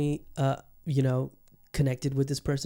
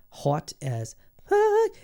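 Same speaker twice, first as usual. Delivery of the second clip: slow, much higher, drawn-out hammed-up swoon; swooning gush; 3 dB louder, much slower than usual. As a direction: stretch it out high like you're swooning. Gushing about a hot woman.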